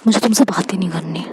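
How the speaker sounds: low, soft hurt whisper; sulky whisper. Acted. Isha tells Anvi she won't talk to her in a sulky whisper. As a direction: breathe it out like a hurt sulk.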